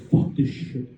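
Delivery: low, bitter cursing; driver at rock bottom. Retold or imitated. Driver who runs a tech company, bitterly fed up. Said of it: "Just fucking down here".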